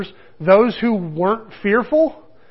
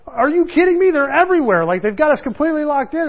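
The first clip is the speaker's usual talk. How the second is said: much higher, disbelieving outburst; can't believe what he's hearing, in a pitched-up voice; incredulous disciple; 8 semitones higher than usual. Retold or imitated. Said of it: "And he was like".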